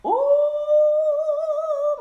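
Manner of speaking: very high, long sung "ooh"; shocked, sustained falsetto hoot; scandalized ooh. Real, not acted. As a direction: hold a piercingly high ooh. Shocked at the letter.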